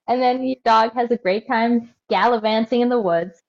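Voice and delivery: ordinary talk, nothing marked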